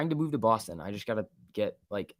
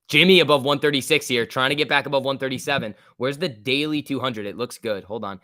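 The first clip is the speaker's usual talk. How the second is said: normal talking voice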